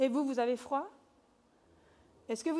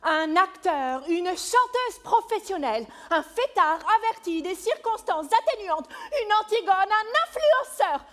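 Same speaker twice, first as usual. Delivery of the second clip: stage actor's big delivery; theatrical monologue; 4 semitones higher, 9 dB louder than usual. Acted. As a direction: declaim it grandly, loud. Theatrical monologue of a personified fate.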